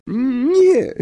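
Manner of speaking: very high, loud wailed sob; sobbing. A narrator's reading. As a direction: wail it like a weeping girl. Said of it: "załkała Lavender". (zawodzący szloch)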